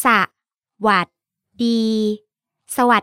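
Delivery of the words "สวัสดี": each syllable said apart; broken into syllables. Shown as a demonstration. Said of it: "Can you break it down for us?".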